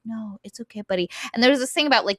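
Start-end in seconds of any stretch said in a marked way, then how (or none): 0.0-1.1 much softer, soothing reassurance; calming paladin voice. Retold or imitated. Paladin calming the frightened young assassin, soft soothing voice. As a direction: settle a panicked kid gently. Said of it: "they can make their voice very calming".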